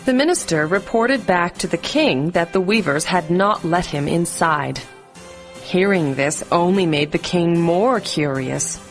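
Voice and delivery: usual speaking voice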